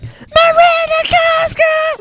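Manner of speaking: very high, drawn-out squeaky yell; squeaky shout. Retold or imitated. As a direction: shriek the name in a sped-up chipmunk voice. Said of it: "I'm like".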